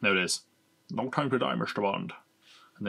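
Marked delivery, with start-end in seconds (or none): 0.9-2.1 a faint Connery Scottish lilt; Connery talking to himself in the mirror; light Sean Connery accent. Retold or imitated. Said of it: "that's what Sean Connery says in the mirror".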